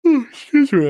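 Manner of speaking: words stretched through a big yawn. Real, not acted. Streamer apologizing to chat mid-yawn, yawning. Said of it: "I'm yawning".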